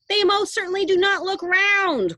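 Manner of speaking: high, loud bratty cry; cried. A narrator's reading. Veruca Salt crying out at Wonka, high and loud. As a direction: stamp your foot with the voice. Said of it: "cried Veruca Salt".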